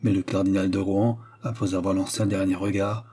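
ordinary delivery

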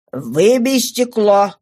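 slow, insistent order; repeated command. Acted. Father repeats it to hesitant daughter, slow forceful command. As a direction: stretch the order out firmly. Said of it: "повторил он".

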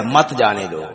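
"مت جانے دو" low, in one breath; soft, unbroken prohibition مت جانے دو; مت joined to جانے. Shown as a demonstration. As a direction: run مت straight into جانے دو after the pause. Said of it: "اتھے سا کڈیا".

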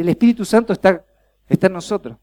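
Espíritu run off fast, then está en nosotros eased down; quick, light repeat turned to the neighbour. Shown as a demonstration. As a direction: rattle it off quickly to the person beside you. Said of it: "Mirá al de al lado y decirle".

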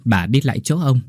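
ordinary delivery, nothing marked

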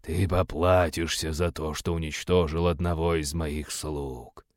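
slow, low and soft menace; demonic whisper. Acted. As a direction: threaten slowly in a low hiss. Demonologist threatens the hero.

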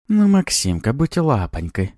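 high-pitched wheedling plea; ingratiating succubus begging a favour; fawning. A narrator's reading. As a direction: coax sweetly, drawing out the pet name. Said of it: "начала лебезить суккуба".

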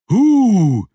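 loud high troll hoot. A narrator's reading. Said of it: "said the troll".

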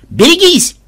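high, loud shout of alarm; urgent warning cry. A narrator's reading. Warning the party as a huge bat flies out, alarmed shout. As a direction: call out a sudden warning.

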